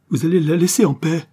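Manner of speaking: loud, high outburst; desperate demand. A narrator's reading. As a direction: repeat the order at full force, near breaking point. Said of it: "Un sanglot l'étrangla".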